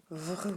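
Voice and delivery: "vr" run together as one drawn-out vrr; blended consonants. Shown as a demonstration. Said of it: "Ensemble, ça fait".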